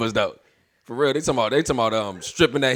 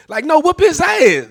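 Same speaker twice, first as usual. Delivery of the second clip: high, slow exasperated demand; exasperated outburst; 12 semitones higher, 5 dB louder, slower than usual. Retold or imitated. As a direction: plead with the mother to act. Urging mother to discipline kid.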